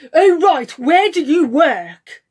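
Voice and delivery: loud, drawn-out mock demand; imitating arrogant man. Mimicking the driver demanding where she works, arrogant. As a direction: put on an arrogant, slow interrogation. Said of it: "This is the way this arrogant man".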